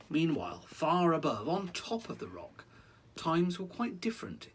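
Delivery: lively rhyming read-aloud; story narration. A narrator's reading. Parent reading a rhyming story to a child. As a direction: read it like a bedtime picture book.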